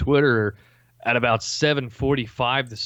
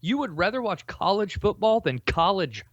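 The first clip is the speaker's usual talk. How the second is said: high, disbelieving outburst; can't believe what he hears; incredulous; 7 semitones higher than usual. Real, not acted.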